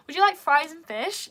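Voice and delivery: a hammed-up American accent asking would you like fries; mock American server. Brit mimicking an American cast member serving food, in a mock American accent. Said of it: "I can't imagine Americans in the U K section".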